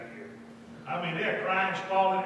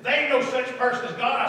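louder, higher defiant retort; retold defiant denial; 6 semitones higher, 10 dB louder than usual. Girl to preacher denying God, defiant. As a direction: retell her loud protest.

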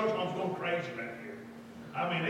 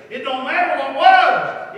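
loud, high, drawn-out declaration; forceful insistence; 7 semitones higher, 17 dB louder, slower than usual. Retold or imitated. Preacher to hurt girl, forceful reassurance.

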